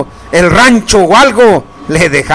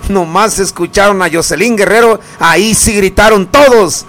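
ordinary talk

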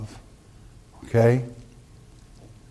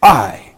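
"I" hit hard and louder, low; emphatic I; 4 semitones lower, 6 dB louder than usual. Shown as a demonstration. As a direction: punch the word "I" like a proclamation. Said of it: "that's emphatic in the Hebrew".

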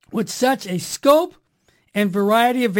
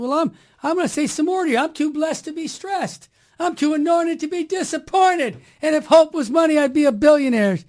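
higher, brighter retold pep talk with rising energy; upbeat; 7 semitones higher than usual. He cheers up the Scottish shop lady with his upbeat catchphrases. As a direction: cheer her up like a preacher, pitched higher. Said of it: "And I said".

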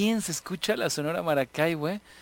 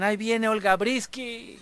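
mock call, pitched up to warn the boy; retold yell; 5 semitones higher than usual. Kids yelling a warning at the boy in the street, mock call. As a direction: call it out like teasing the kid. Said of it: "y le gritaban".

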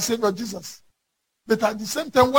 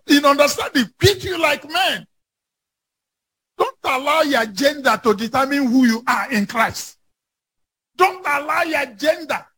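fiery preaching; 7 semitones higher than usual. Acted. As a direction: preach it hard to the congregation. Preaching at full force.

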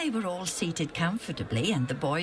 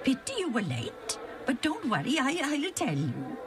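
high, chatty storyteller voice; friendly old man; 9 semitones higher than usual. Acted. Andronicus greeting the boys, high and friendly. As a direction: play a kindly eager friend.

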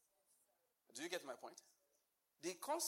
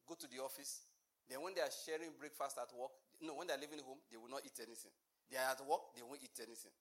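ordinary talk, nothing marked

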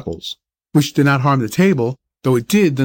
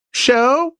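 a shrill bird cry as he's grabbed; screamed by the bird; 11 semitones higher than usual. Acted. Magpie caught by the tail screaming at the boy. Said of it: "screamed the bird".

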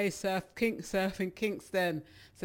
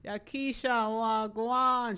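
ordinary talk, nothing marked

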